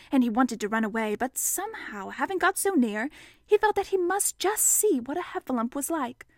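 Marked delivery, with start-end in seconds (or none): none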